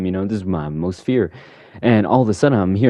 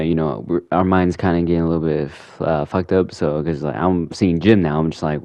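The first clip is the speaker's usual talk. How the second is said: ordinary delivery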